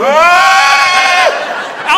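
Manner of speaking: long, loud high scream; panicked shriek imitated at full voice; high-pitched scream. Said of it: "I heard this high-pitched screaming".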